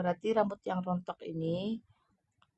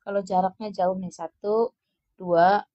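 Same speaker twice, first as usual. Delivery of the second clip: ordinary talk, nothing marked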